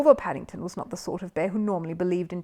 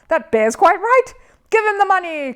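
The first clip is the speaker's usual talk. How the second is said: high, loud heckler; audience shout; 12 semitones higher, 11 dB louder than usual. A narrator's reading. Audience member shouting at the host for the bear. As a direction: call out from the crowd. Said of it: "cried a voice in the audience".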